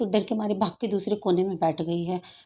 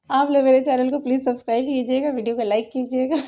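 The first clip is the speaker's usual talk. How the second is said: higher, louder sign-off asking viewers to subscribe; cheery vlog outro; 4 semitones higher, 4 dB louder than usual. Real, not acted. Vlogger signing off to her viewers, upbeat outro voice.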